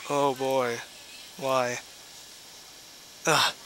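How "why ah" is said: normal talking voice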